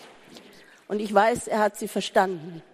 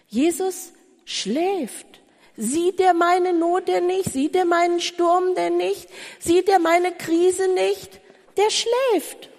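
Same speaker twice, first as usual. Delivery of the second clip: high, pleading voice asking why Jesus sleeps; anguished complaint; 8 semitones higher than usual. Acted. Preacher voicing a desperate believer, pleading and high. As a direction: cry out the believer's despair at God's silence.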